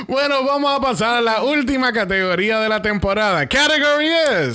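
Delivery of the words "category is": high, rising category call; ball emcee. Pretend.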